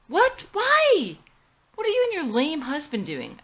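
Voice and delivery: put-on high, loud party-girl squeal; bubbly Lane. A narrator's reading. Lane calling from the beer garden, bubbly and excited. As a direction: gush like a tipsy party friend.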